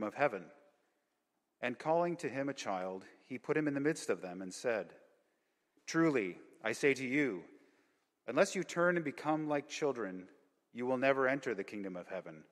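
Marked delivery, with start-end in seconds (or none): none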